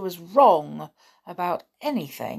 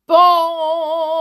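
loud, high ringing bong; clock bell chime; 10 semitones higher, 12 dB louder than usual. A narrator's reading. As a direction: imitate Big Ben, letting the note ring out. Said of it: "Ben began to chime".

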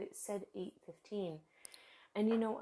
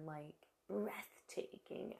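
hushed whisper; 9 dB quieter, slower than usual. Real, not acted. Awed about fall colors.

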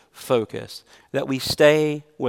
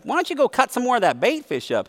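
imitating Chris, much higher and fast; a high, fast mock of Chris; Chris's way of talking; 10 semitones higher, faster than usual. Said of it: "he talked like this".

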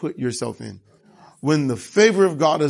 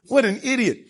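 laughing; 7 semitones higher than usual. Real, not acted. Laughing at the foolish king.

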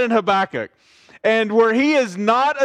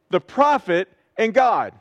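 ordinary talk

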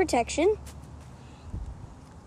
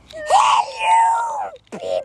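Boop Beep voice; 5 dB louder, slower than usual. Acted. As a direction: shout like a silly robot creature. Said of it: "that was my accent for Boop Beep".

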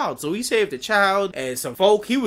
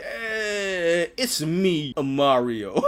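lazy Mario impression; much slower than usual.

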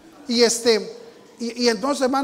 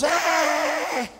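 crowd cheer; 8 semitones higher, 4 dB louder than usual. Retold or imitated.

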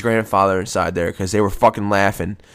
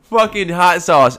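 amused disbelief; 5 semitones higher, 4 dB louder, slower than usual. Real, not acted. Laughing at hot sauce.

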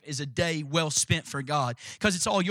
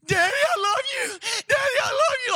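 put-on very high, wailing little-girl voice; scared little girl. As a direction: cry it out like a terrified child saying goodbye. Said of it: "Like she was about to die".

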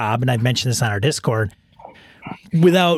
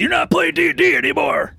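mock scolding shout. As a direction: yell it like a fed-up dad.

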